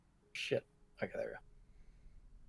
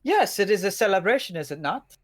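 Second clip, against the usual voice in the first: put-on Dutch accent; 6 semitones higher, 4 dB louder than usual. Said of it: "Dutch accent".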